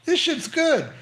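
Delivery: high, slow, savoring reaction; impressed reaction. Retold or imitated. To his listeners, imitating himself hearing the song: high, slow delight. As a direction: pitch it up and slow, savoring the riff. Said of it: "I was like".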